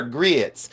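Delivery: GRITS drawn out as "greets"; mock Southern "greeets"; imitated Southern drawl. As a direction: mimic a long Southern vowel in GRITS. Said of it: "I love their accent".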